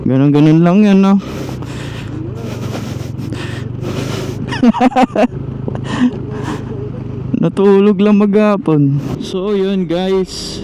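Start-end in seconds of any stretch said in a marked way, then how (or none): 1.2-7.4 breathy laugh. Real, not acted. Laughing after joke.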